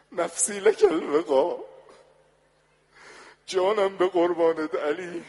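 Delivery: high, loud wailing plea; grieving lament. Acted. Fatima calling to Ali in grief, high loud wail. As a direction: cry out to him as if your heart breaks. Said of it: "گفت: دو تا جمله گفت".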